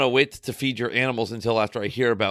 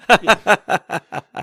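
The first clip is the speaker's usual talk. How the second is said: loud, high laugh at own joke; burst of laughter; 8 semitones higher, 7 dB louder than usual. Real, not acted. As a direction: crack up at the pun.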